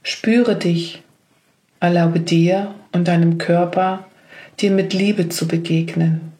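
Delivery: ordinary delivery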